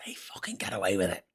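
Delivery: low, hushed aside; bitter undertone, almost voiceless; whispered indignation. Real, not acted. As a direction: whisper it with quiet disgust. Indignant hushed aside about the rich.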